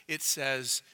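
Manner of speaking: ordinary delivery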